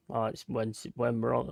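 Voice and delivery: usual speaking voice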